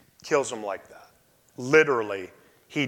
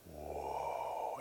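stunned hushed whoa; 11 semitones lower, 18 dB quieter than usual. Retold or imitated. Patient hears cancer news.

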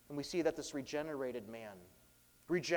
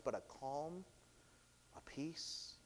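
near whispered, gentle; preacher hushed describing the man's new calm; soft tender; 13 dB quieter, slower than usual. Real, not acted. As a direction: breathe the peace out. Describing peaceful healed face.

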